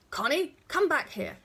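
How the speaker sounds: put-on angry father shouting after his child; loud shout. A narrator's reading. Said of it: "he shouted loudly".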